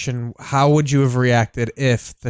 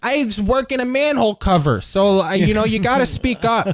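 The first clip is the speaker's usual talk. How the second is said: mock Chicago worker; 8 semitones higher than usual. Mocking Chicago workers' voices.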